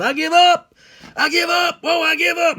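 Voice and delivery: very high, loud holler; hollered surrender. Retold or imitated. Old hunter giving up to his pursuer in the woods, high holler. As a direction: cry out in a high, panicked-sounding yell. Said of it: "He hollered".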